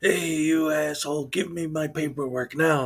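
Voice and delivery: ordinary delivery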